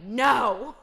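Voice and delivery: loud, sharp retold yell of no. Wife shouting at husband wanting to do dishes, a loud yell. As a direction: shout him down, no!. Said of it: "I yell".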